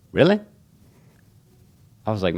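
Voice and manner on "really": much higher, louder really; delighted disbelief. Retold or imitated. Barber told they want to learn from him, much higher surprise. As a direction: squeal it in surprise.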